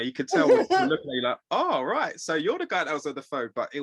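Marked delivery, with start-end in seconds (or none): 1.5-3.5 higher, lightly imitated manager; a hint of the manager sizing up a caller; manager's reaction. Manager meeting the phone applicant, lightly imitated surprise. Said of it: "he was like".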